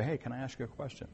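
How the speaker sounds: soft, quick, unforced question; calm, no shouting. Retold or imitated. Paul getting beaten yet asking a question, quiet and calm. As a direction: ask quietly and politely, no protest. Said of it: "He doesn't shout it".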